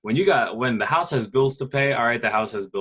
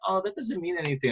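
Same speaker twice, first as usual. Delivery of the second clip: lightly imitated girl, pitched higher; dismissive freshman girl; 5 semitones higher, 4 dB quieter than usual. Girl dodging the ID check, lightly imitated. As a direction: brush it off in a higher mimicked voice. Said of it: "They'd be like".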